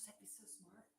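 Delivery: whispered, very soft; hushed reaction under the breath; murmured realisation. Real, not acted. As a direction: whisper it to yourself as the idea lands. Reads viewer's idea, reacts to herself.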